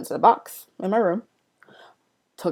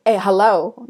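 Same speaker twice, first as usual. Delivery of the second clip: a light loud imitation of the brother's "Hey, hello"; brother's greeting; 4 semitones higher, 10 dB louder, slower than usual. Imitating how the brother greets people, loud and curt. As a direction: mimic how he addresses people. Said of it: "how he says it's like".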